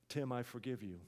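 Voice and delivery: low, hushed pardon; quiet, tender retold reply; gentle forgiveness. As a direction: forgive him softly, voice dropped. Retold president quietly forgives staffer.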